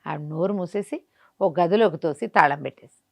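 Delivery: ordinary delivery, nothing marked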